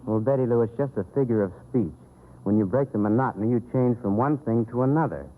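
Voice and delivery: ordinary talk, nothing marked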